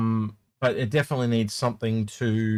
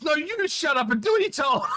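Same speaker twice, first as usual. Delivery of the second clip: high, shouted comeback; mock angry retort. Teammates snapping back at the rude player, high mock shout. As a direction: fire it back at the heckler. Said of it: "It was the other two guys".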